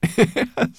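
short, high, loud laugh; quick burst of boyish laughter; boy's laugh. A narrator's reading. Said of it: "The boy laughed".

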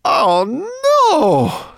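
high, drawn-out wail of dismay; exasperated howl; loud groan. A narrator's reading. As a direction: groan it at full volume. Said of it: "Sorrel groaned".

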